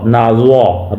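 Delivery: normal talking voice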